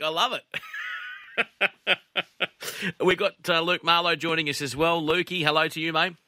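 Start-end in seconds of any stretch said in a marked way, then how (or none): 0.0-3.0 laughing through it, drawn out; can't hold the laugh in; host cracking up at his mate's story, laughing. Real, not acted.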